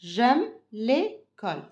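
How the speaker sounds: J'aime l'école said slowly and clearly, linking J'aime; teacher modelling slowly; slow model sentence. Shown as a demonstration. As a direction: stretch out J'aime l'école for pupils to repeat. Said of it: "on dit comment?".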